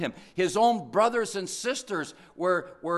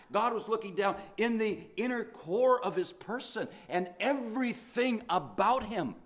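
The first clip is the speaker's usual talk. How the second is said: ordinary talk, nothing marked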